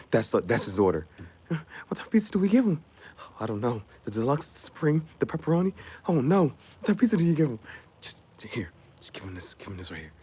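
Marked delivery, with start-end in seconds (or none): 1.5-7.6 high, fast panicked cook; panicking server. Acted. 8.0-10.1 whispered, much softer; hushed. Acted. Servers whispering in skit.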